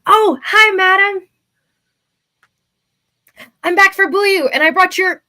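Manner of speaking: high, loud eager youth; bright nervous young adventurer; young hero voice. Acted. Player voices his character.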